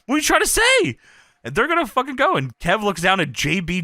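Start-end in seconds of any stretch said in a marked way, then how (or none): 0.1-1.0 much higher, rising outrage; flipping out. Retold or imitated. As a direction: demand an answer, incensed. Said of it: "Booker's flipping out".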